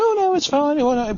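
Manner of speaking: high, breezy dismissive owner voice; mimicking dog owner. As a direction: brush it off in a high voice. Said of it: "they go".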